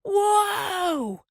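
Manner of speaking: very high, drawn-out holler; yelled command. A narrator's reading. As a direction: call desperately for the team to halt. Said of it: "yelled Almanzo".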